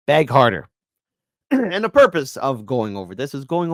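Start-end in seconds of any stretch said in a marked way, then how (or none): 0.1-0.7 punchy mocking command; louder taunt. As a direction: demand it louder, gloating. Taunting clip of begging pundits.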